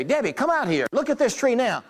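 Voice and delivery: calling Debbie out, proud; mock yell to wife. Husband calls wife to see the tree, a mild mock yell. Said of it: "And now I yell at my wife".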